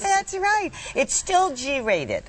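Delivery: high, slow puppet voice; prim little lamb; Lamb Chop voice. Pretend. Puppet voice reply.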